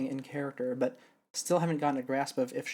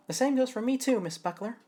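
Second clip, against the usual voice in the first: lightly voiced Athena; 9 semitones higher than usual. Voicing Athena greeting.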